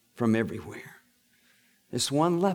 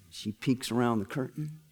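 usual speaking voice

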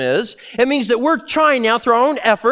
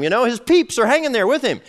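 ordinary delivery

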